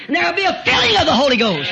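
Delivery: high, loud shout; preacher building to a shout; preaching shout. Real, not acted. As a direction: shout the promise out. Preacher's climax.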